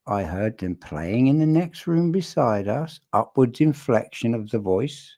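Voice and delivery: "beside us" and "voice" lifted at the end like questions; imitated upwards inflection. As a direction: mimic uptalk, rising on "us" and "voice" as if unsure. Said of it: "When you hear the upwards inflection of the voice like that".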